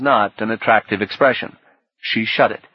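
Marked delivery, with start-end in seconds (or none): none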